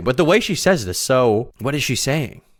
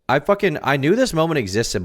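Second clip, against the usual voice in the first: ordinary delivery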